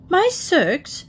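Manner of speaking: high, startled question; worried, high-voiced query; alarmed exclamation. A narrator's reading. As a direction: exclaim in alarm, pitch jumping up. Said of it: "exclaimed Paddington, looking more and more alarmed".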